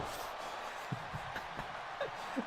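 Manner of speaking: long helpless high-pitched laughing fit; can't stop the giggle. Real, not acted. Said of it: "How they said his name wrong".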